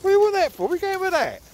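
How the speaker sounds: squeaky pet voice, teasing; high dog-talk. Real, not acted. Owner teasing dog over a stick, squeaky baby talk.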